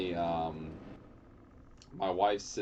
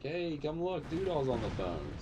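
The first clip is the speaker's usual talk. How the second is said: imitating wife; 7 semitones higher than usual. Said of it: "she'd be like".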